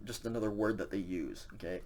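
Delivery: usual speaking voice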